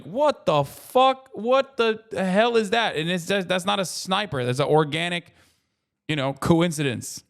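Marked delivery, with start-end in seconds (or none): 0.0-2.9 much higher, slow, stretched-out shocked outburst; stunned passerby. Retold or imitated. Stranger stumbling on the streamer, high drawn-out shock. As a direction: gape at him like he came out of nowhere. When someone just goes like.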